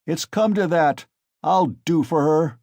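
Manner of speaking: loud furious threat; drunken rage. A narrator's reading. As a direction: spit out the threat. Mikolka in a rage.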